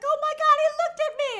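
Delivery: high, loud gushing over Superman; giddy fangirl squeal. Retold or imitated. Robot fangirl gushing over Superman, squealing high. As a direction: squeal it like a starstruck fan. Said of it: "she's a Superman fangirl, 'cause she's like".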